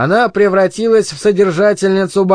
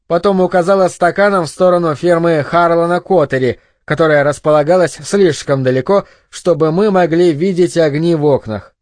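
ordinary delivery, nothing marked

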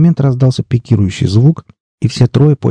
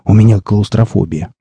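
ordinary delivery

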